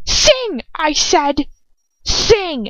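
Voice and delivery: high-pitched, furious order; enraged command. A narrator's reading. Woman banging her fist on the table, demanding a song in rage. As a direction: order it in a rage, pitch leaping on each "sing". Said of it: "her eyes narrowing with rage".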